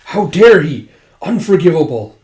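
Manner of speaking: loud, indignant exclamation; retold angry outburst; acted outrage. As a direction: declare it an outrage. Soldiers outraged at kidnapping.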